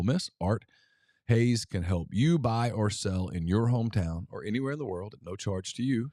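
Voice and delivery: host's sponsor pitch; ad read. Acted. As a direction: sell the realtor with a casual host pitch.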